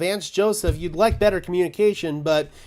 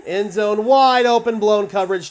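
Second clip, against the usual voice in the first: louder and higher, rising to a burst on wide open; excited call; 5 semitones higher, 7 dB louder than usual. Real, not acted. Fan watching the game shouts as the touchdown happens, excited. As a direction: call the score like you can't believe it.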